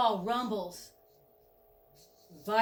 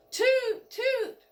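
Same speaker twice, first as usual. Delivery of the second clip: very high falling toots; train whistle toot; 5 dB louder than usual. Pretend. Narrator voices the train whistle, high toots. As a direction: pipe it high like a steam whistle.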